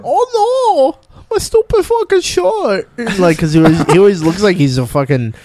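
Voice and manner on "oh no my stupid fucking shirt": high whiny mock voice; mocking Adam Sandler. Friend mocks Adam Sandler, high and whiny. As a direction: whine it up high. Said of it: "You're like".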